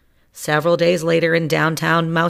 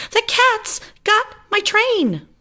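panicked squeaky mouse; 4 dB louder, slower than usual. Acted. Engineer panicking.

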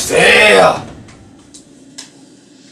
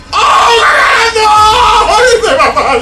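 shrieking; 14 semitones higher than usual. Real, not acted. Panicked cries in fight.

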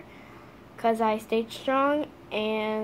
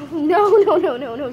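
laughing no; 5 semitones higher, 7 dB louder than usual. Real, not acted. Dog grabs toy.

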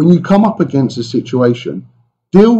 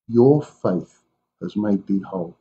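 softer, slower, tender; gentle reassurance; 6 semitones lower, 6 dB quieter than usual. Acted. Jesus reassuring the frightened woman, softer and slower. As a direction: soothe her gently, slowly.

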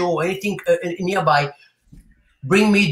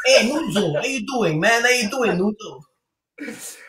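playful, higher-pitched Italian-American greeting; French-Italian accent; 3 semitones higher, slower than usual. Acted. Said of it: "Your French-Italian accent".

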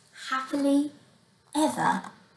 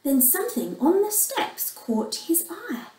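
ordinary delivery

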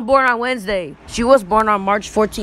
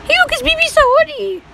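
high squeaky voice. Pretend.

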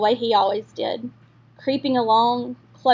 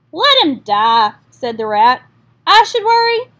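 callous; 4 semitones higher, 7 dB louder than usual. Acted. Rat callously refuses.

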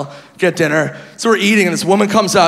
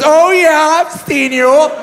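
high-pitched imitation of a fan; excited older woman; 9 semitones higher, slower than usual. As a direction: gush like a thrilled Green Bay lady. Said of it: "She goes".

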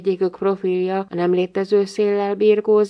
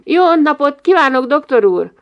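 raised, loud polite greeting; called greeting; 7 semitones higher, 8 dB louder than usual. A narrator's reading. Boy greeting the doctor on landing, loud and polite. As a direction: hail the doctor brightly and formally.

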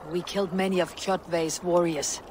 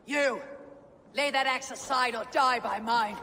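higher, hard-edged warning; angry threat; 6 semitones higher than usual. Acted. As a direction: menace the enemy into dropping the axe. Threatens enemy over slain father.

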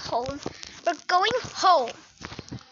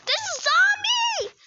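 panicked shout; 13 semitones higher than usual. Acted. Trapped student shouts a zombie warning in panic. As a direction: shriek the warning. Telling the principal.

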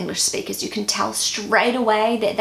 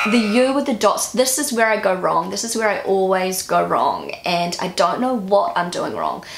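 ordinary talk, nothing marked

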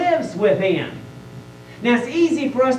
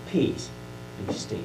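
very calm voice; 12 semitones lower, 10 dB quieter than usual. Acted. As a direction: lower and much softer, unhurried command to the storm. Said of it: "in just a very calm voice".

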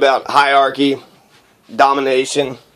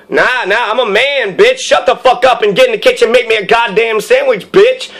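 mock macho tirade, pitched up; hammed-up angry commands, hard on each phrase; domineering man; 4 semitones higher than usual. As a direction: order her about like a bossy brute. Acts out a domineering man ordering woman.

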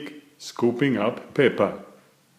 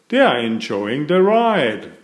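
ordinary delivery, nothing marked

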